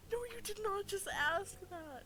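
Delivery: high, loud shocked disbelief. Real, not acted. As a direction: cry it out in disbelief. Reacting to embarrassing question.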